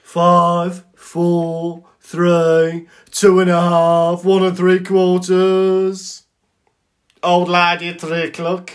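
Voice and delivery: high, loud Brummie countdown; Brummie shrunken head. Acted. Shrunken head counting down and warning, loud Brummie accent. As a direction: call it out in a hammy Brummie accent. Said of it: "imagine a Brummie shrunken head".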